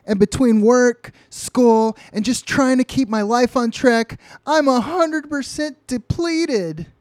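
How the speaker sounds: loud, high-pitched overwhelmed complaint; acted tearful rant. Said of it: "Luann is crying, and she's saying".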